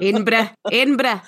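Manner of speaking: "Edinburgh" squeezed short, Scottish-style, as EDIN-bruh; Scottish pronunciation. Shown as a demonstration. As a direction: say "Edinburgh" like a local Scot. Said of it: "sort of".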